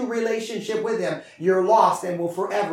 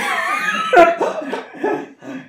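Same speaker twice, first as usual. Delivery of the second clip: high delighted laugh; 5 dB louder than usual. Real, not acted. Preacher laughs at passion.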